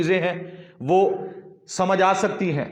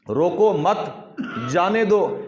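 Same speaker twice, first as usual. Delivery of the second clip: "روکو مت" as one group, silence, then higher "جانے دو"; pause after مت; 3 semitones higher, much slower than usual. Shown as a demonstration. Teacher repeats the changed sentence, pausing after "مت". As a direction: break only after "مت", lifting "جانے دو". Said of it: "مت پر وقف کریں گے".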